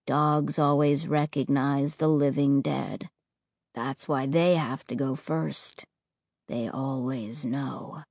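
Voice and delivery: deep, slow and cold; low, flat tone. Acted. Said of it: "in a low, flat tone".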